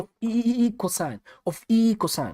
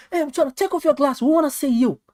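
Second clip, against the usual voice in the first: higher, lightly voiced viewer request; quoting a viewer; 9 semitones higher than usual. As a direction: toss off a viewer's request, pitched up. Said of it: "'cause I'm saying".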